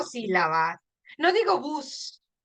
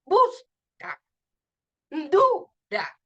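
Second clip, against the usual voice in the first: "Busca", "Dura" with BUS and DU high and strong; teacher splitting "Busca" and "Dura" at the stress; stressed syllables; 5 semitones higher, 4 dB louder, much slower than usual. Shown as a demonstration. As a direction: punch the first syllable, pause, then a small "ca" and "ra". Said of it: "se pronuncia con más fuerza".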